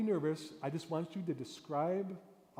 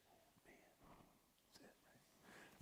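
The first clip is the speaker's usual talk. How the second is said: hushed whispered aside; 29 dB quieter than usual. Shown as a demonstration. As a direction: whisper it like a secret. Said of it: "whispered aside".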